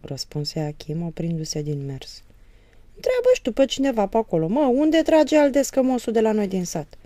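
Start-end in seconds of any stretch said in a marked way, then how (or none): 2.9-7.0 sarcastic. Acted. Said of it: "îl lămuri Moromete sarcastic".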